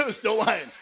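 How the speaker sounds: normal talking voice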